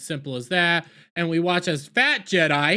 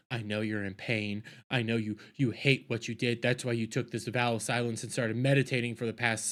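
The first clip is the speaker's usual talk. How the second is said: low, hushed pressure, imitating May; quietly corner him with the choice; soft menace; 5 semitones lower, 5 dB quieter than usual. May laying poison down before Torben, hushed threat.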